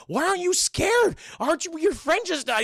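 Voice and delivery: high, loud mock disbelief; agitated challenge. Imitating Liv confronting John over his dead friend, loud agitation. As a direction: demand answers, exasperated and hammed-up.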